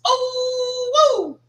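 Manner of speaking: high, loud "oh, woo!" of delight; excited whoop. Real, not acted. Hyping the night game in Lorman, a high excited whoop. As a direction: whoop it up like the party's starting.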